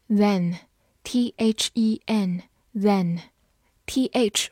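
letters spelt out slowly; slow classroom spelling. Shown as a demonstration. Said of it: "spelling a word for learners".

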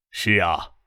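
low, subdued short reply; low-voiced official. A narrator's reading. 张之行简短回应副市长. (低沉轻声地应答)

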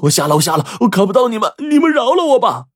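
high, loud anguished wail; crying out in pain. A narrator's reading. Blinded Wang Mao wails to the robbers for mercy. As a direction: howl for mercy through the pain. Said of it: "王茂是边流血边喊".

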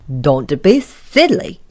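low, loud, slow scorn; snorting. Acted. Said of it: "snorted Mister Uppity".

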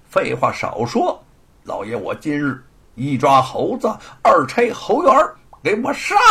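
high, loud scornful lord; arrogant bully. Acted. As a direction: order the raid with contempt. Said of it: "罗鹏笑道". (嚣张跋扈的老爷)

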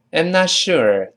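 slow, careful "I'm not sure". Shown as a demonstration. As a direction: give it slowly for learners. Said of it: "第二人说了". (放慢示范读)

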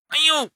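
high cartoon yelp; pained yelp. A narrator's reading. Little monkey gets hit by a stone, yelping.

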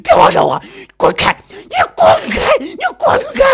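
shrieking rage building to a raw peak; hysterical screaming. Acted. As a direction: scream threats at full force, very high and loud. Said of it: "女人嘶喊着".

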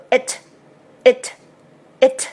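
"it" cut very short with a sharp t; brisk, neat "it" with a short vowel; crisp and clean. Shown as a demonstration. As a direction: snap out each "it" separately. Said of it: "一定要发得非常的干脆利落".

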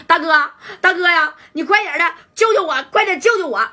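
panicked pleading. Acted. Pei Gang begging his big brother on the phone, panicked and high. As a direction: plead like you're cornered.

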